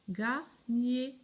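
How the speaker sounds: "Gagner" split into ga, gner; syllables sounded out. Shown as a demonstration. Teacher joining ga and gner for pupils, sounded out by syllable. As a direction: say "Gagner" as two firm, separate syllables. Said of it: "La syllabe ga avec la syllabe nier".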